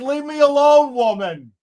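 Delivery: high, loud melodramatic brush-off; hammed-up whine. Mocking the ex-cop snapping at his wife. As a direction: wail it like a sulking drama queen. Mock dramatic reply.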